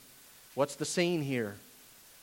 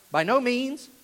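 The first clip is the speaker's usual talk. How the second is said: higher, insistent declaration; said louder; 6 semitones higher, 3 dB louder, slower than usual. Shown as a demonstration. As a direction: repeat it with more force, pitched up. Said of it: "Say it louder".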